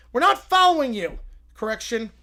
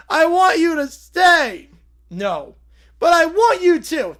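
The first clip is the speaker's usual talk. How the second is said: whining; 5 semitones higher, 7 dB louder, slower than usual. Acted. Kid begs Borg to stay.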